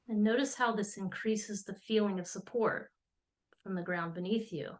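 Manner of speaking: measured meditation voice with long pauses between phrases; guided meditation. Acted. Astrologer leading listeners into an earth meditation, measured and calm. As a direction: guide listeners gently into settling, unhurried.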